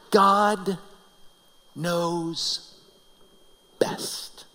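one word at a time, long pauses; solemn emphasis. Real, not acted. Preacher drives home the main lesson, solemn and fading. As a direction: let each word sink in, fading to a whisper.

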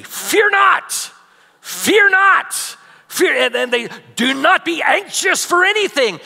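mocking a guilt-trip sermon, shouting fear not; harsh preacher shout. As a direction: thunder it like a condemning preacher. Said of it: "guilt-based sermons ... and they say".

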